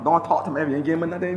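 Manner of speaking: ordinary talk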